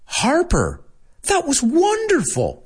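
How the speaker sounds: put-on high, gushing praise for a child. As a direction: rave about the kid, pitch way up. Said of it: "acted scene line".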